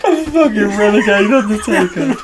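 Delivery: high, loud words squeezed through laughter; real laughing. Real, not acted. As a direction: barely get it out for laughing. Still cracking up at the phrase.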